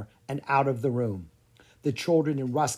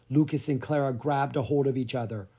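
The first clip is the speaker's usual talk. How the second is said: ordinary delivery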